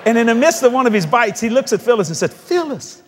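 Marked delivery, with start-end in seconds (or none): 2.3-3.0 a first, milder high call of the name; Keith's voice. Retold or imitated. Husband first calls wife Phyllis, slightly high. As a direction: start the name higher than usual. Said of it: "he's got that high voice".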